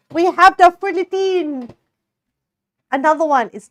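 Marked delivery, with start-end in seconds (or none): 0.1-1.7 high, loud, hammed-up fake French accent; attempted French accent. Streamer playing at baking for listeners, mock French accent. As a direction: announce it in a badly faked French accent, pitched up. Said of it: "What if I did in a French accent?".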